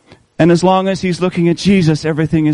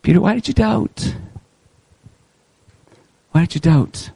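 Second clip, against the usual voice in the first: gentle rebuke; 2 semitones higher, 3 dB quieter than usual. Acted. Jesus to Peter, a soft tender question. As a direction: ask it tenderly, almost hurt. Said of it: "the question that he asked to Peter".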